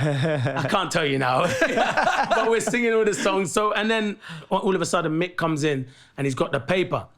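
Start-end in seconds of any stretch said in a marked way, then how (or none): 1.5-2.2 a high burst of real laughter at the memory; amused laugh. Real, not acted. Telling the World Cup story.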